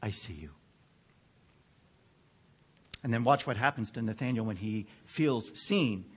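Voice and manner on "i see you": low, hushed murmur, only a hint of whisper. El Roi speaking into someone's loneliness, low hushed near-whisper. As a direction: drop the voice low and soft. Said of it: "El Roi stands whispering".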